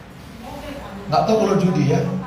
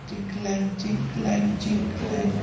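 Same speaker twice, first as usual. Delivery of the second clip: soft, higher clinking spoon noise; clinking cup sound; 6 semitones higher, 7 dB quieter, much slower than usual. Retold or imitated. Wife stirring her husband's coffee, soft clinking imitation. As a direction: mimic a spoon tapping a cup. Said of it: "Bukan cingkleng".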